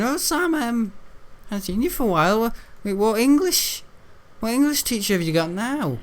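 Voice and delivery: high, light imitation of the librarian; mock librarian; librarian's voice. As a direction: put on the old librarian's voice. Librarian voiced in retold story.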